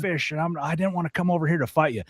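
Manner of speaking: put-on high, sheepish old-man voice; backpedaling old man. Old man seeing the younger man, backing off in a high sheepish voice. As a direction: suddenly back down, all apologies. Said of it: "He's like".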